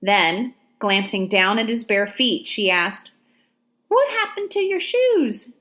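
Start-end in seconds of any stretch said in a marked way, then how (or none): 3.9-5.5 put-on much higher little girl's voice, curious; young girl. A narrator's reading. Ladysmith questions the barefoot soldier, little girl voice. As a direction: ask like a wide-eyed child.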